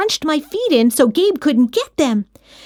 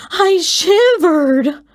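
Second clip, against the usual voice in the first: ordinary delivery, nothing marked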